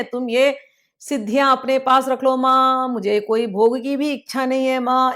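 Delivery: usual speaking voice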